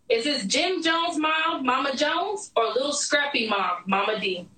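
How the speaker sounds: usual speaking voice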